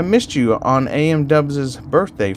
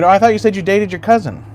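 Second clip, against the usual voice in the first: ordinary delivery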